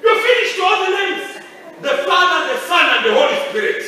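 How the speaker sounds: full-force pulpit shout; preaching shout. Acted. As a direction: belt it out to the congregation.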